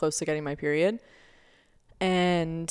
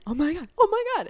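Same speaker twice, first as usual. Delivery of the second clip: rising to a very high excited squeal; building from surprise to delight; grandma learning of the baby, squealing with joy; 13 semitones higher, 4 dB louder, faster than usual. Retold or imitated. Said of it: "she got all excited".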